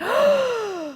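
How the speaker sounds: high, sudden gasp of recognition; sharp gasp. Retold or imitated. Friend realizing who she met, sharp high gasp.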